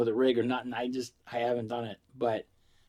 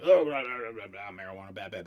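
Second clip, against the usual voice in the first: mocking brother; 3 semitones lower than usual. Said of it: "He's like".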